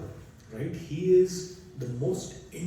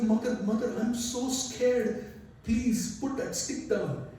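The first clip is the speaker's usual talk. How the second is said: higher, slow frightened pleading; scared child; 8 semitones higher, slower than usual. Acted. Little Krishna begs his mother to drop the stick, scared and slow. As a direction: beg like a terrified little boy. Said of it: "he's so afraid of his mother".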